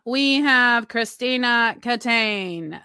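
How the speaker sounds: slow, drawn-out ring announcer call; hammed-up announcer introducing the challenger; boxing announcer. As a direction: announce the challenger like a prize fight.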